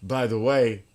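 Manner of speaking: put-on a casual English accent, done lightly. [name] imitates Nick's English accent to listeners, lightly. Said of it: "in his English accent".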